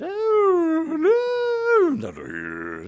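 very high, loud squealing greeting; shrill devil falsetto; Him falsetto. Acted. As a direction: shriek a creepy welcome. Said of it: "my own spin on it".

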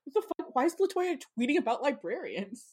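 high, hushed puzzled voice; mock bafflement. Imagining followers reacting to her tweets, mock bafflement. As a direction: imitate baffled onlookers, high and soft. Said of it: "everyone's like".

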